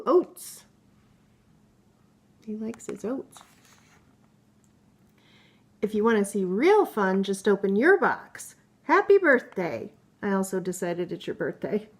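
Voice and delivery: playful book characters. Acted. Mother reading a picture book to her child, lively character voices. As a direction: read the animal friends' squabble with bounce.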